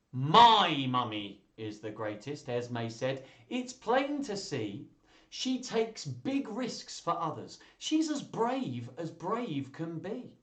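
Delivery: put-on slow, high-pitched reading to children; story read-aloud. Reader voices a child proudly praising her mummy, animated storytelling. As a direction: stretch out the key words with big pitch swings.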